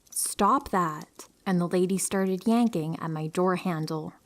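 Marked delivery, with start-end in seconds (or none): none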